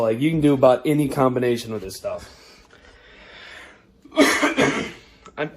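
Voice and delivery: usual speaking voice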